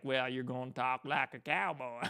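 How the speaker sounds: slow cowboy American drawl; mock Western American accent. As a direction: stretch it out like a cowboy, American South. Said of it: "doing a stereotypical American accent".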